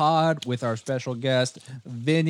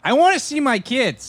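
yelling demand at the door; shouting; 8 semitones higher, 6 dB louder than usual. Acted. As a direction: shout it, desperate father. Acted custody quarrel, shouting.

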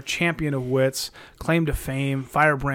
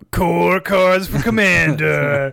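smooth monster car voice; 4 dB louder, slower than usual. Retold or imitated. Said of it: "smooth monster car voice".

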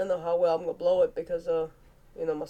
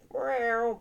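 high playful cat meow; 6 semitones higher than usual. Pretend. Cat noise for Bengals.

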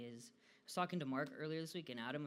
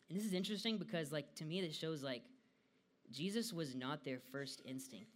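ordinary delivery

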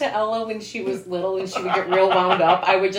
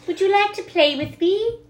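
put-on high child voice, innocent invitation; high-pitched little-kid question; little girl; 9 semitones higher than usual. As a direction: play a small child asking a new friend.

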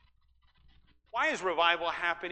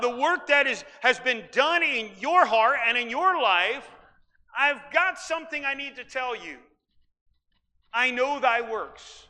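raised, louder preaching voice; 4 semitones higher, 5 dB louder than usual. Acted. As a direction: proclaim it from the pulpit, higher and louder. Preacher voicing Jesus' message.

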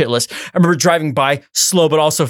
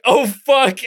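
laughing oh fuck; 7 semitones higher than usual. Retold or imitated. Sees aggressive driver pull alongside.

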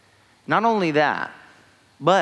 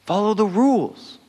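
stressed; 8 semitones higher, 4 dB louder than usual. Shown as a demonstration.

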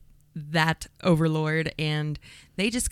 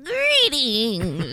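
raised, louder crone greeting; old hag witch voice; 4 semitones higher, 4 dB louder than usual. Acted. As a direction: croak it out like a cackling witch. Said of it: "Is that your witch cackle sh- voice?".

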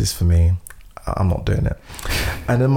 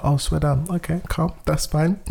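higher, soft, forced calm; retold brush-off of rejection; mock-cool acceptance; 8 semitones higher, 4 dB quieter than usual. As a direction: pretend not to mind. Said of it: "So I'm like".

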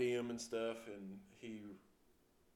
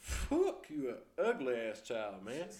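higher, loud, slurred insult; retold drunk yell; 4 semitones higher, 7 dB louder, slower than usual. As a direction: bark it like the drunk on the curb. Drunk man insulting officer.

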